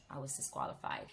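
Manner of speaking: normal talking voice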